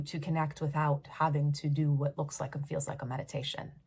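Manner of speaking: ordinary delivery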